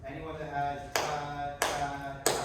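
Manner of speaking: separated "ta, ta, ta" with pauses; spaced rhythm syllables. Shown as a demonstration. Director contrasting spaced rhythm with the crowded one. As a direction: leave air between each "ta". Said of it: "More space".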